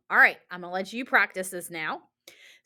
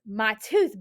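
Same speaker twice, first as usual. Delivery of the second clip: usual speaking voice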